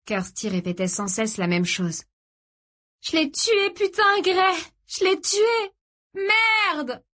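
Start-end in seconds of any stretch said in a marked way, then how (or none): 3.0-7.0 high, frantic cries in a nervous breakdown; panicked high-pitched outburst; hysterical panic. A narrator's reading. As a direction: cry it out high, in full hysterics. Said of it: "Elle était en pleine crise de nerfs".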